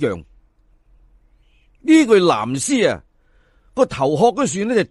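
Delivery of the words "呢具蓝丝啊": ordinary delivery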